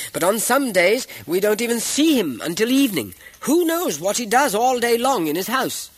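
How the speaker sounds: high, loud cut-in from the crowd; loud interruption. A narrator's reading. Second townsman interrupts to pile on, loud heckle. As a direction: shout over the first speaker.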